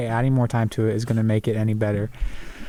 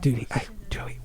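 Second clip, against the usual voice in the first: whispering; 5 dB quieter than usual. Pretend. Whispered complaint continues.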